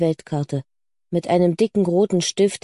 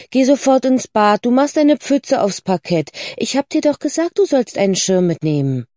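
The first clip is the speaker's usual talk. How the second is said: higher, louder exasperated telling-off; scolding; 5 semitones higher, 4 dB louder than usual. Acted. Mother scolding soaked Lucy about the puddle, exasperated. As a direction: order the child off, vexed.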